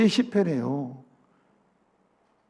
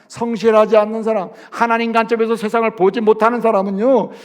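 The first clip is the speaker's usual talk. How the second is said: normal talking voice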